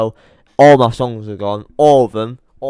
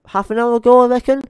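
unsure guess; 10 semitones higher, 4 dB louder, faster than usual. Real, not acted. Unsure about the time.

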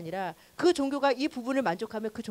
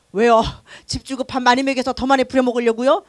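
loud suspicious question, louder; indignant question; 8 dB louder than usual. Retold or imitated. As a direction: ask sharply, smelling a trick. Imagined listener objection. (크게 의심하며 묻기)

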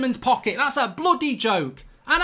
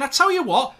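ordinary delivery

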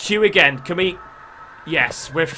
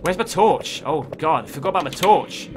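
ordinary talk

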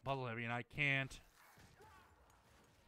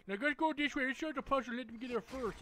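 higher, sing-song nagging voice; mocking impression; 8 semitones higher than usual. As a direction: mimic the annoying companion. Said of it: "She's fucking annoying".

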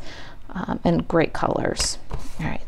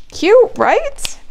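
much higher and louder squeal of delight; 6 dB louder than usual. Real, not acted. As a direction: gush over how cute it is. Admiring the finished crocodile.

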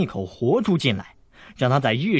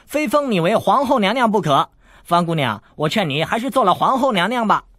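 higher and louder, quick playful banter; brash flirting tease, higher and louder; cheeky teasing; 7 semitones higher, 4 dB louder than usual. Acted. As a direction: tease her shamelessly. Teasing flirt, rising loud.

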